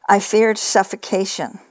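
usual speaking voice

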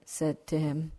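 ordinary delivery

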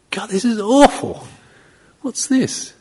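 high-pitched mock dismay; disgusted shock. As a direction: recoil from an unexpected, awful taste. Acting out shock at unexpected tea.

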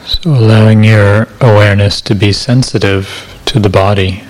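calm, measured meditation voice with long silences; guided meditation. Acted. Meditation teacher settling listeners into body awareness, calm guided meditation. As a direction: guide listeners gently into body awareness, unhurried, evenly paced.

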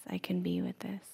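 hushed, accepting murmur; soft self-reassurance. Acted. Meditator telling themself they can stay with discomfort, soft self-reassurance.

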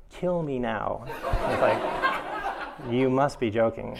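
hammed-up despair through laughter; laughing mock despair. Teacher retelling her itch meltdown, laughing mock despair. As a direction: play the drama of it, laughing. Mind going nuts over itch.

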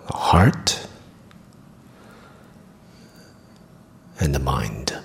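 calm meditation guide with long pauses; guided meditation. Acted. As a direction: guide them gently through the body, leaving long silences.

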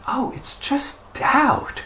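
high, slow dawning "oh"; sudden realization. Retold or imitated. Meditator realizing what the trouble was, dawning surprise. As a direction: draw it out as it dawns on you.

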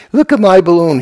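put-on high, loud little child showing off a balloon; child's voice. Teacher imitating a child with a balloon, high and loud. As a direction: squeal it like a proud small kid. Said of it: "children would go with a balloon and say".

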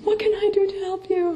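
high, quick, fussing mother's voice; soothing mom. Retold or imitated. Mother hurrying to help her crying child, high and fussing. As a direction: rush to comfort like an anxious mom. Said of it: "And mom is like".

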